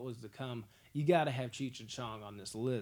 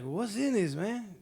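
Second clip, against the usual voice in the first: higher, louder puzzled stoner voice; stoned Cheech asking; 6 semitones higher, 6 dB louder, slower than usual. Retold or imitated. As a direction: ask it like a baffled, half-baked buddy. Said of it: "He's like".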